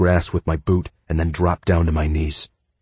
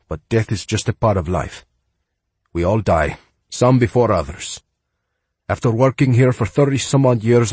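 put-on kindly old groundskeeper, Spanish accent; higher voice, heavy Spanish accent; 5 semitones higher, 3 dB louder than usual. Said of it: "with a heavy Spanish accent".

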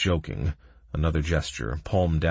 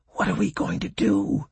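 muttered; 12 semitones higher than usual. A narrator's reading. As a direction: mutter it close to the ear. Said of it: "muttered Doc into my ear".

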